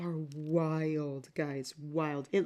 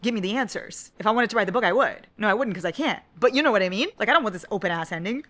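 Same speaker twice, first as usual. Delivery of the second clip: louder, heated rant; real annoyance; 4 semitones higher, 8 dB louder, faster than usual. Real, not acted. Complaining to viewers about the open ending, loud and heated. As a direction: push it out hot and loud. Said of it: "No, I'm actually mad".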